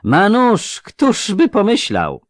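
high, loud, drawn-out jeer; lisping mockery. A narrator's reading. Robber sneering at the boy's knife, jeering. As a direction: feign amazement, slow and mocking. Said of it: "zadrwił ten sepleniący".

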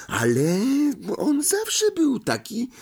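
doubtful, almost pleading; unsure Hannah. A narrator's reading. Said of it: "powiedziała Hanna niepewnym tonem". (niepewnie, wysokim dziewczęcym głosem)

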